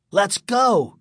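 high, urgent call to friends; shouted. A narrator's reading. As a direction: call out to get them running. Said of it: "Greg shouted to Bird and Michael".

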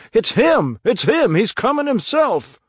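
high, loud cries from the crowd; excited crowd yelling that he's coming; crowd shouting. Acted. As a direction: shout it out, spotting him. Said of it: "voices shouted".

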